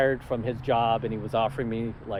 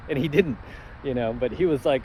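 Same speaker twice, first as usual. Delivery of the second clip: usual speaking voice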